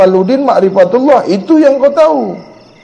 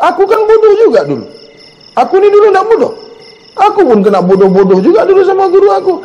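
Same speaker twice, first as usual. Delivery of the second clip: quick, high, lively recollection; animated; 10 semitones higher, faster than usual. Real, not acted. Preacher eagerly admitting his own teacher called him a fool. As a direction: rattle it off brightly, a little higher.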